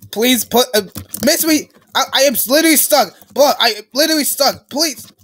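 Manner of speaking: high, loud, rushed complaint; frantic protest. Real, not acted. Player stuck in the game, loud frantic protest.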